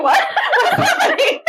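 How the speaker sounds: squeaky high voice, laughing through the words; cracking up in shock, way up high; laughing disbelief. Retold or imitated. Laughing while voicing her own reaction.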